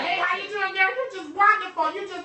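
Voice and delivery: joking with a stranger as Madea, high and loud; Madea impression. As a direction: ham it up as Madea sweet-talking a stranger. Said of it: "be Madea to somebody".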